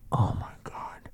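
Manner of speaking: retold whisper of dread; horrified whisper. Storyteller as friend provokes a big stranger, dreading whisper. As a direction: breathe it out under your breath.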